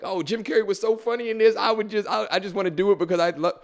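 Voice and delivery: high, loud gushing; mock gushing actor. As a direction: gush like a starstruck fan. Mocks an actor picking monologue for fun.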